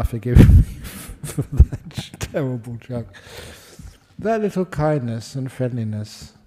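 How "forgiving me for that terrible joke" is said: slow, broken up by real laughter; laughing apology. Real, not acted. Speaker begs forgiveness for his terrible joke, laughing. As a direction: laugh helplessly through the words.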